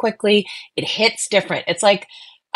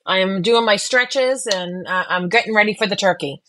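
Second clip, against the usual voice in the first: usual speaking voice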